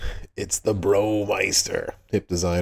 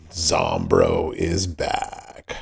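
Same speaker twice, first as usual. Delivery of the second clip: zombie voice; 13 semitones lower, much slower than usual. Pretend. Announcing zombie character returning.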